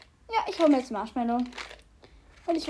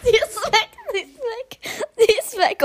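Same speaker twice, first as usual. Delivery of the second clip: high, giggling repetition; laughing; 10 semitones higher, 4 dB louder than usual. Real, not acted. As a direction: laugh through the words. Friend ran off, laughing.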